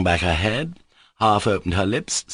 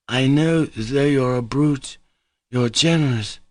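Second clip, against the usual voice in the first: higher, slow, labored words; with difficulty; 6 semitones higher than usual. A narrator's reading. Grushenka struggling to speak, telling Mitya he is generous. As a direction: push each word out with effort. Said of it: "articulated with difficulty".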